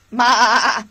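loud bleating maa; hammed-up storytime goat; mock goat bleat.

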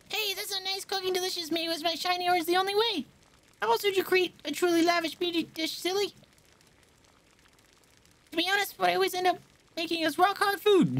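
put-on high, fussy young cook voice; high-pitched cook girl.

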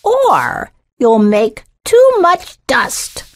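high, slow fussy warning; correcting. A narrator's reading. Missus Gray to Thumbelina sweeping, correcting. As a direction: warn fussily, drawing out the words. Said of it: "Missus Gray corrected".

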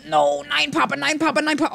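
high-pitched, playful pleading; mock panic. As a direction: plead in hammed-up alarm. Mock panic at a coming goal.

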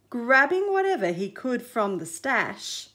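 ordinary delivery

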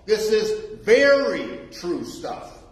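"very" stretched out, slow and weighty; emphatic. Shown as a demonstration. Preacher pressing the point on the congregation, slow and emphatic. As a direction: lean slowly on "very". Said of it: "It is emphatic".

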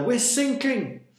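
high, loud cry of alarm. A narrator's reading. Sailor on a sinking boat, crying out. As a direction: shout the alarm to everyone aboard. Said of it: "cried a sailor".

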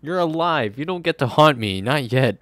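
high, loud playful scolding; startled protest. Real, not acted. Player yelling at Brad's ghost on screen, high and loud. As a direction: tell the ghost off, half laughing.

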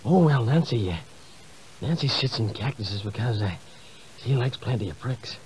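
low, soft Reagan voice; Ronald Reagan impression. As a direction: vague old president rambling.

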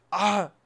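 short, loud, high cry; startled yell. Real, not acted. Player under fire in the game, startled yell. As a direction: yelp sharply at a sudden hit. Player nearly hit in fight.

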